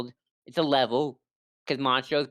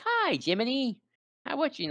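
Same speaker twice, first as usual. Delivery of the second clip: high little puppet voice; Pinocchio; 12 semitones higher than usual. Retold or imitated. Pinocchio greeting Jiminy, high put-on voice. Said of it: "And he's like".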